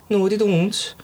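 Irish attempt that comes out Welsh; mock Irish accent. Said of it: "that sounds Welsh".

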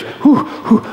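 two breathy whews; mock relieved exhale. Pastor mimics an anxious believer exhaling, mock relieved puffs. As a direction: blow out air in mock relief. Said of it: "need some relief really quick".

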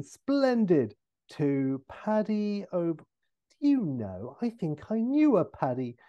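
lifted jovial voice, slowing as it dawns; Austin Powers. Acted. Austin signing for Paddy, then half remembering him, trailing off. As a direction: begin brightly, then trail off in recognition. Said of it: "And, uh, Austin".

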